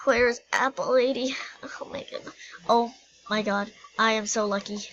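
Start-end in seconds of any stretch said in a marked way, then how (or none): 1.6-2.3 whisper. Pretend. Whispered shock.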